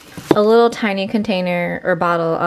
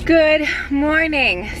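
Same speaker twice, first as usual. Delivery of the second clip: slow, high, sing-song good morning; drawn-out greeting; 8 semitones higher, much slower than usual. Real, not acted. Greeting her viewers at the start of the day, slow and sing-song. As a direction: stretch it out brightly for the camera.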